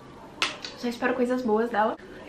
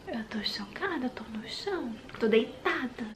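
soft unvoiced whisper; ASMR whisper; 6 dB quieter than usual. Shown as a demonstration. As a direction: whisper your shock ASMR-style. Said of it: "eu tô viciada em A S M R".

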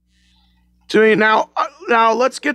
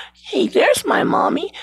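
put-on little kid voice; 9 semitones higher than usual. Lost kid spots his mother.

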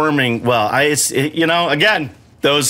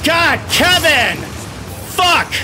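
frustrated yell; 11 semitones higher, slower than usual. Real, not acted. Player gets hit by boss.

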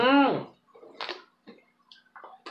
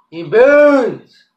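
louder and higher, really hurting; louder pained cry; 4 semitones higher, 9 dB louder than usual. Real, not acted. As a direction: cry it out, burning worse.

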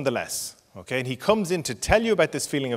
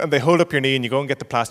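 ordinary talk, nothing marked

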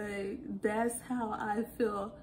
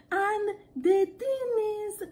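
high, slow, drawn-out exclaiming; exaggerated sister talk; 9 semitones higher, 3 dB louder, slower than usual. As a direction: wail it slowly, way up high. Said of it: "We were just speaking like that".

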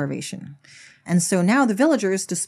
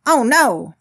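higher, louder exclamation of alarm; mock alarm; 5 semitones higher, 5 dB louder than usual. Farmer exclaiming to himself in acted alarm. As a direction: cry out in storybook dismay. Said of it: "exclaimed Ben to himself in alarm".

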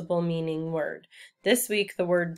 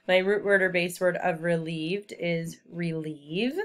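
ordinary talk

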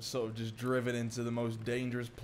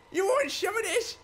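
much higher, louder mocking challenge; shrill taunt; 4 dB louder than usual. Real, not acted. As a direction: goad the enemy, pitch leaping up. Taunting Hardy the enemy.

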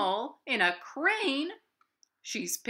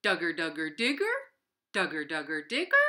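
digger noise; 6 semitones lower, faster than usual. Acted. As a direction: rumble low then pop up on Digger. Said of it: "the noise went like this".

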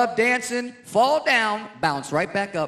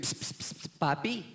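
hissed call; 4 semitones lower, 9 dB quieter than usual. Retold or imitated. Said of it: "She'll walk up to any guy".

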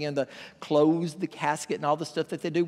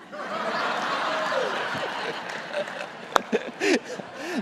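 big laugh; 12 semitones higher than usual. Real, not acted. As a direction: burst of real laughter. Punchline lands, preacher laughs.

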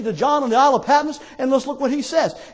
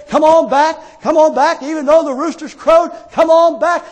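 raised, loud rhythmic calling; urgent altar call; 4 semitones higher, 6 dB louder than usual. Real, not acted. Preacher calling failed believers back to the Lord, urgent calling. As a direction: call them home, pushing each phrase. Preacher calls backsliders to return.

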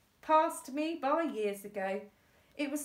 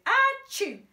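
high, very loud explosive sneeze; an enormous sneeze; 12 dB louder than usual. Acted. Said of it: "The sneeze was much louder".

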